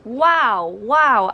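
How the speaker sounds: loud, high, drawn-out disbelief; real astonished outburst; stunned wow. Real, not acted. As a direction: exclaim it big, appalled by what you heard. Stunned by terrible accent.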